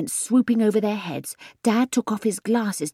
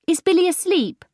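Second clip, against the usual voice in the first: high, louder call to a window; 12 semitones higher, 5 dB louder than usual. Acted. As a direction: call up from the lawn to the house. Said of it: "Mum called".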